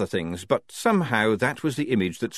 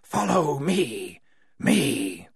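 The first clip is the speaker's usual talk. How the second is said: ghostly echo; 5 semitones higher, much slower than usual. Acted. Ghostly echo repeats Wazza.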